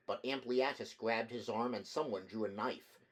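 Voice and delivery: ordinary delivery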